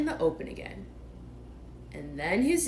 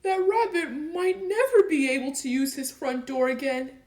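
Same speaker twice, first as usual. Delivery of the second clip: sniffling; 7 semitones higher, 5 dB louder than usual. Acted. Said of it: "sniffing slightly".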